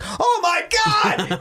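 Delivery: very high, slow shocked squeal; fish panics. Pretend. Hooked fish shrieking in shock, high and drawn out. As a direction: shriek as the hook bites.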